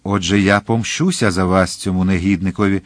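plain reading voice

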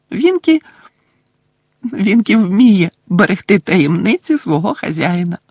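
high squeaky elf voice, proud; loyal house-elf. Acted. (віддана ельфійка)

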